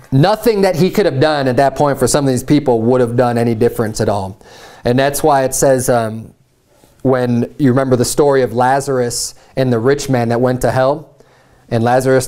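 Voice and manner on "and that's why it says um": ordinary talk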